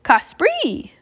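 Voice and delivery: high squawk swooping up then down; raven croak. A narrator's reading. Annie calling Jack to fly, croaking. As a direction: squawk it like an eager bird. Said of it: "croaked Annie".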